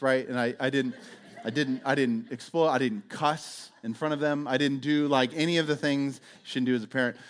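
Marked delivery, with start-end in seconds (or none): none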